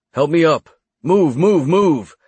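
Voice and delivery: loud barking sergeant orders; drill sergeant voice. A narrator's reading. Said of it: "with my best drill sergeant voice".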